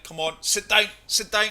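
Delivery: high, loud pleading with ball; will the ball to stop; excited urging. Real, not acted. Urging tee shot to stop.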